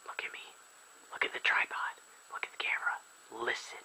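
hushed, breathy asides while the game loads; whisper. Real, not acted. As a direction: whisper it to the camera. Whispering to camera during loading.

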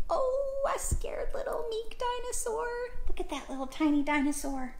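tiny high, soft voice; scared little meek dinosaur. Acted. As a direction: go small and squeaky-soft, timid. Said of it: "how about dinosaur meek".

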